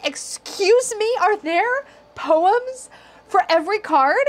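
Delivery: high, slow, stunned question; astonished. Real, not acted. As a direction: gasp it out in disbelief. Amazed discovery of poems.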